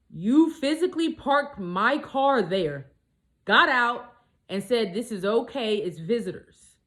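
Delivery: loud, slow, high-pitched mimic of his claim; mock outraged quote. Telling a friend, mimicking the guy's claim in loud outrage. Angry retelling of his words.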